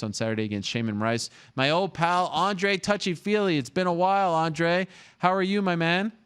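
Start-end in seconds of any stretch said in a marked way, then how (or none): 1.6-6.1 excited greeting. Real, not acted. Host greets returning guest warmly.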